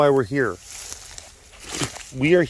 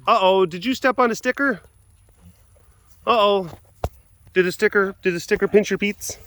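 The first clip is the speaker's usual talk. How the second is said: high-pitched cooing concern; 8 semitones higher than usual. Real, not acted. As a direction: coo it high, as if to a pet. Baby-talks a pet about sticker.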